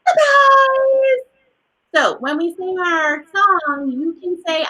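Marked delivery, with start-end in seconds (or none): 0.0-1.3 surprised demo. Shown as a demonstration. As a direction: gasp and high, loud, drawn-out cry. Demonstrating surprise in the wrap-up.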